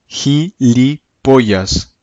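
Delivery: teacher drawing out "Gilipollas" at half speed; very slow demo. Shown as a demonstration. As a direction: say "Gilipollas" very slowly, syllable by syllable. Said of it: "teacher slows new word".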